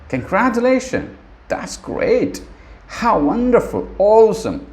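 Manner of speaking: bright high excited praise; rising delighted congratulations. Shown as a demonstration. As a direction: gush over a friend's good news. Teacher demos happy response.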